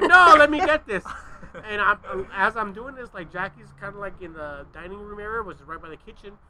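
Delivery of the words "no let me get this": high, loud pleading; rising high insistence. Retold or imitated. Son strong-arming dad for the broom, high and loud. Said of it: "My voice keeps going higher and higher".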